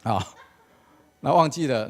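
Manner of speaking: ordinary talk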